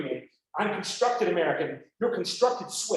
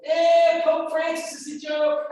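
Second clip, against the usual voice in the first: mocking student; 8 semitones higher, 6 dB louder, slower than usual. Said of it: "if you go".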